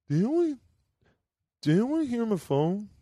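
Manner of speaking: high, hesitant imitation of a puzzled coworker; sheepish imitation. Coworker asks the room about his phone, imitated sheepishly. Acts out the man asking sheepishly.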